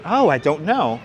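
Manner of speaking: ordinary talk, nothing marked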